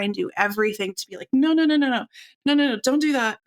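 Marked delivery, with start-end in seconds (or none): 1.3-3.4 hurried, higher-pitched pleading no-no-no; frantic placating. Retold or imitated. Mother retelling how she tried to hush her screaming toddler, mock-frantic placating. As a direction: imitate a parent scrambling to stop a meltdown.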